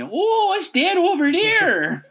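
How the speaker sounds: high, slow gawking tourist voice; Canadian tourist. Pretend. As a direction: gush like a Canadian sightseer. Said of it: "our best impression of a Canadian tourist".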